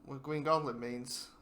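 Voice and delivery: halting, tripping over the words; mumbling, stumbling. Real, not acted. Speaker losing the Green Goblin line mid-thought, mumbling and stumbling. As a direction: stumble through it with broken restarts. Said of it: "I'm mumbling, or I'm stumbling right now".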